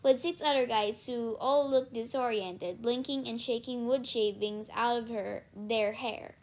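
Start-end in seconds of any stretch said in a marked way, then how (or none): none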